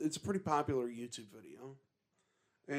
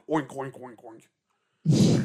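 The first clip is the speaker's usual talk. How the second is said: mock pig oinking, bursting louder at the end; pig oinks; 11 dB louder, much slower than usual. Friend adding pig noises to the ghost quote, joking oinks. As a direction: oink like a pig.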